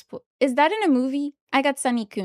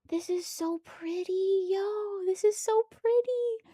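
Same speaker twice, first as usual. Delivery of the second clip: awed gasp; 7 semitones higher, 7 dB quieter, slower than usual. Real, not acted. Gasps at a pretty card.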